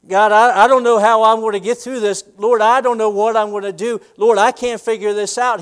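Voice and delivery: high, loud helpless plea; desperate prayer. Retold or imitated. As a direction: cry out in confusion. Believer's desperate prayer voiced.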